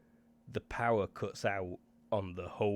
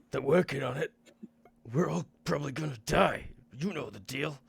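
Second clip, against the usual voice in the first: higher, louder voiced crew member; a raised, tense crew voice; NPC voice; 7 semitones higher, 8 dB louder than usual. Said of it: "He says".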